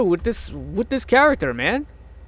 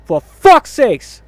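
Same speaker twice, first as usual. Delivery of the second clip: slow, high, loud curse; exasperated outburst; 6 semitones higher, 10 dB louder, much slower than usual. Real, not acted. Fan fed up with movies today, exasperated. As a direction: cry it out, fed up.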